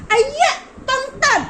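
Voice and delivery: loud, high startled cry; startled shout. Real, not acted. A vlogger startled by something off camera, shouting. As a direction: yelp it out in surprise.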